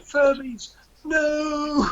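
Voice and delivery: high squeaky cry of Furbies, no; high-pitched yell. Retold or imitated. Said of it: "like, high pitch".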